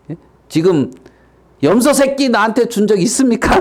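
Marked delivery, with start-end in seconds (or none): 1.6-3.6 high, indignant complaint; raised-pitch aggrieved question; angry son. Acted. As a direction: throw a grievance at your father. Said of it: "화내고 있는 이".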